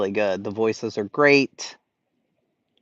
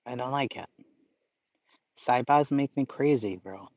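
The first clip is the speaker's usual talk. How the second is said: ordinary talk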